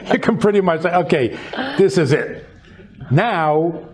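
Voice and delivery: chuckling through the words; amused, laughing aside. Real, not acted. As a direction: let a real laugh run under the line. Laughing while quoting midlife thought.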